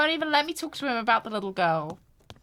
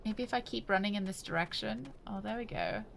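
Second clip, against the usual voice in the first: softer, to yourself; quiet; 9 dB quieter than usual. Real, not acted. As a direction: mutter it under your breath. Game path found.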